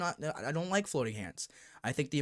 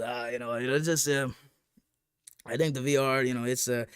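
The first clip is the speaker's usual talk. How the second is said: a light put-on accent, mocking the guy; lightly voiced mock accent for the clueless YouTuber; 2 semitones lower than usual. Mocking a YouTuber asked about VR, light put-on accent. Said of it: "I'm adding the accent 'cause I think it's more fun".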